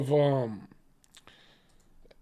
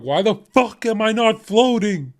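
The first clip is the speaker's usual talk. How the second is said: high, louder outraged yell at being left behind; exasperated yell; 8 semitones higher, 6 dB louder than usual. Retold or imitated. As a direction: shout it in disbelief when the rapture skips you. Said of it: "I'm gonna be like".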